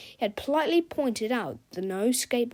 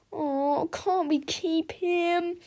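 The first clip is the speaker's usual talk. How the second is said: pleading; 8 semitones higher, much slower than usual. A narrator's reading. Harold pleading.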